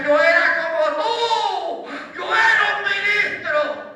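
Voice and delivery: high, drawn-out preacher's cry, re-enacting a confession; retold anguished shout. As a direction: cry it out slowly at full voice. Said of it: "abrió la boca gritando y me decía". (grito agudo y prolongado, recreando una confesión)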